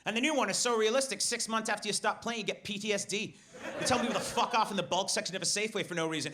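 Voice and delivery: usual speaking voice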